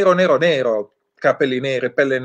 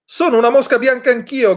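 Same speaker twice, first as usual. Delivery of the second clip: much higher, croaked crow voice; cawing crow; 9 semitones higher, 3 dB louder than usual. A narrator's reading. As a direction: caw it out like a crow. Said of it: "gracchiò una cornacchia".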